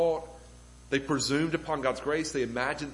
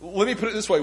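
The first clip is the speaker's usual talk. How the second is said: ordinary talk, nothing marked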